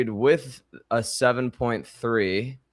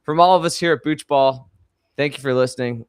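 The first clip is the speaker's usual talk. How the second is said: podcast sign-off; 4 semitones higher, 5 dB louder, faster than usual. Real, not acted.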